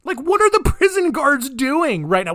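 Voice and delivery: loud, high-pitched exasperation; incredulous rant. Real, not acted. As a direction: demand an answer, loud and high in disbelief. Incredulous rant about the guards.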